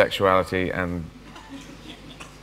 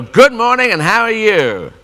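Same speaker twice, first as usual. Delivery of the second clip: loud, high, relentlessly cheery greeting; aggressive politeness; 10 semitones higher, 6 dB louder, slower than usual. Retold or imitated. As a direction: greet them with forceful cheer. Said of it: "aggressive politeness".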